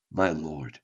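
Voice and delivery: very low, hushed answer; humbled reply. Acted. Poet answering God's call, low hushed submission. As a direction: drop right down, soft and submissive.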